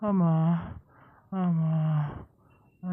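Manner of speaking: weak falling groans; moaning in pain. Acted. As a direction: groan for mother, badly hurt. Injured man moans. (ನೋವಿನಿಂದ ನರಳುವ ದನಿ)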